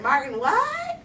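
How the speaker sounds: put-on dispatcher voice, drawing out the question; confused operator. Operator baffled by small town's name, exaggerated confusion. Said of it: "She's like".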